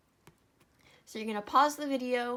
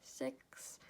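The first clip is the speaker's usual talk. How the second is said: whispered count; 4 semitones higher, 10 dB quieter than usual. Real, not acted. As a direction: whisper the number. Counting stitches quietly.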